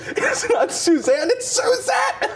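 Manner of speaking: high, drawn-out mock shriek; imitated freak-out. Passenger correcting driver on her name, high mock freak-out. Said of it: "she, like, freaks out".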